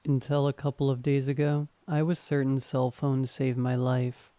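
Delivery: ordinary delivery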